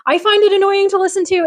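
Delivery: ordinary talk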